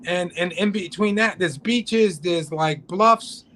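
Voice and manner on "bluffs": ordinary talk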